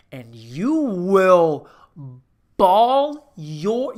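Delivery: loud, very slow, stretched words; big comic build, stretched and loud; drawn-out emphasis. Real, not acted. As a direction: belt each word out long for effect. Hyping how hard you will cry.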